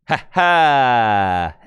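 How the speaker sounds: loud drawn-out gloating laugh; showy victory laugh rising high; triumphant cackle. Real, not acted. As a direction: crow with laughter at a small victory. Gloats after learning key shortcut.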